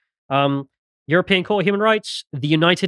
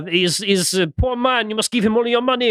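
mock French accent; 7 semitones higher than usual. Host plays the European court pleading for the man, mock French accent. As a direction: draw it out as a hammed-up French plea. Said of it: "they're French as well".

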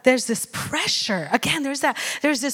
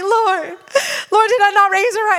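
high, louder half-laughing cry to the Lord; mock wail; 7 semitones higher, 3 dB louder than usual. Mother crying out to the Lord over her daughter, mock wail. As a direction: ham up a mother's despair.

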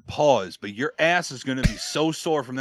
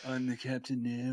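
failed Jack Sparrow attempt; 12 dB quieter than usual. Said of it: "can you do a Jack Sparrow saying".

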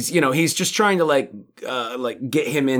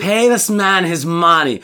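slow, higher, louder mock Russian villain; mock KGB line; 5 semitones higher, 4 dB louder, slower than usual. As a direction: stretch it out like a poker villain. Repeating line in character.

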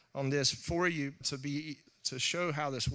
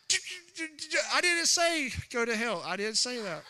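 put-on child stammering; 8 semitones higher than usual. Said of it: "it's like".

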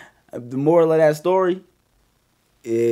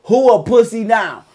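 loud retold taunt; 7 semitones higher, 5 dB louder than usual. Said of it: "His exact words".

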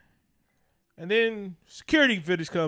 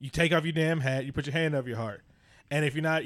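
ordinary talk

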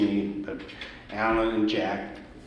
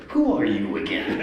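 retold question pitched way up; mock incredulous challenge; 10 semitones higher, 4 dB louder than usual. Ray to the cheeky young music director, high mock incredulity. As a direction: imitate Ray, high and taken aback.

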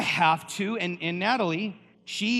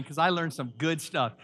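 usual speaking voice

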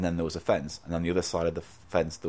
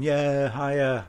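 mock cheering; 6 semitones higher, much slower than usual. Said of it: "they're going".

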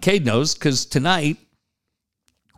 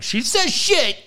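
heated; 13 semitones higher, slower than usual. Retold or imitated. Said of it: "man, I'm pretty heated".

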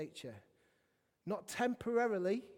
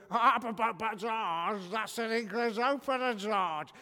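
loud, put-on puppet voice; Zippy; 2 semitones higher, 7 dB louder, slower than usual. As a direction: blare it out like a cheeky TV puppet. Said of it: "Zippy, you've then got to go".

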